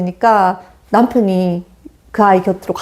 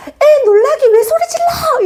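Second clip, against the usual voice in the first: imitated mother's shout. Mother yelling at her husband for scaring the child, mock shout. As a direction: shriek at the husband, hammed up. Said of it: "이렇게 엄마도 소리를 지르더라는 거야".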